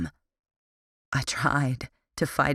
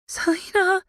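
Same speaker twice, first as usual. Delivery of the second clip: much higher, frightened whimper of a name; a terrified sister crying out; 3 dB louder than usual. A narrator's reading. Captive sister calling out in fear, high whimper. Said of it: "Lily whimpered".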